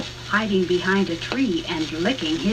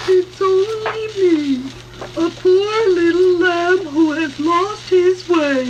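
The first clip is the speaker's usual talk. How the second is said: high, loud, slow sweet put-on voice; a wolf pretending to be a lost lamb; fake lamb voice; 9 semitones higher, 6 dB louder, slower than usual. Wolf tricks the pigs at door, fake high lamb voice.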